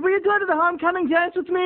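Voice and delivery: high-pitched acted anxious invitation; nervous blurting.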